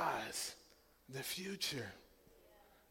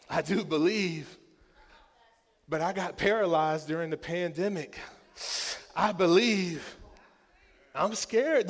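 loud, high pleading cry; impassioned preaching; 5 semitones higher, 11 dB louder than usual. Acted. Pastor voicing the father's plea to the Lord, loud and high. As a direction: plead with heaven at full voice.

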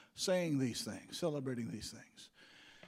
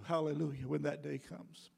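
ordinary delivery

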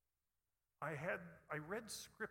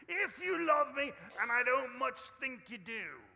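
put-on pirate accent pushed much higher and louder; hammed-up pirate; 8 semitones higher, 14 dB louder than usual. Preacher voicing a grumpy pirate Jesus, loud hammed-up pirate accent. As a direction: overplay the pirate, loud and high. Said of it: "I always read it with a pirate's accent".

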